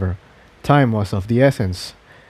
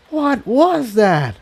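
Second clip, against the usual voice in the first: high, slow, stunned asking; wide-eyed question; 11 semitones higher, slower than usual. Acted. Tiptoe, eyes wide, asking about the vanished support. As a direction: ask in awed shock. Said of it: "her eyes wide".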